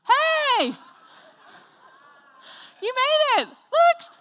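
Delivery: high, loud shout of welcome; hammed-up cheering husband; imitated excited greeting. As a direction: holler joyfully as if greeting a finisher. Acts husband greeting her in lobby.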